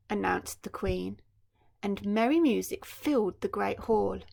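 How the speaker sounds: ordinary delivery, nothing marked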